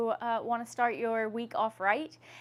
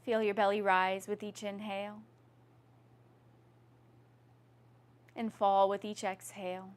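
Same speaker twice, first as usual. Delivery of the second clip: yoga instruction. Acted. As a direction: lead the class gently through breath. Leads a guided yoga breathing practice.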